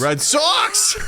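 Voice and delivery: high laughing cheer back; fan cheer. Pretend. Echoing the Red Sox cheer, high laughing shout.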